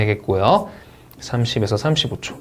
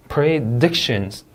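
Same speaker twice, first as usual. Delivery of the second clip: "Predictions" slow, stress on DIC; careful word stress; much slower than usual. Shown as a demonstration. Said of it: "강세 주의에서 한번 읽어보겠습니다". ("Predictions"의 DIC에 강세)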